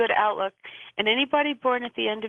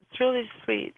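normal talking voice

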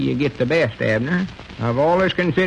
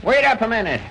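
raised, higher call to a man down the street; 7 semitones higher than usual. Acted. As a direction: hail him from a distance. Calling out down the street.

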